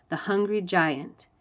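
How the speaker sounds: plain reading voice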